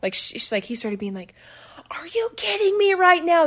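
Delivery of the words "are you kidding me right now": high, angry mock yell. Teller acting the boyfriend yelling at her in the car, high mock yell. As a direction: snap it out like the furious ex. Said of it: "Yelling at her".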